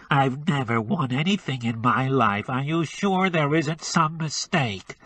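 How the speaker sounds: pinch the sound into the nose; nasal. Acted. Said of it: "he said nasally".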